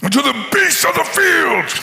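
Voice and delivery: high shouted giant threat; giant's taunt. Acted. Goliath taunts David, shouting high and hammed-up. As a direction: roar the taunt across the valley. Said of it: "He said to David".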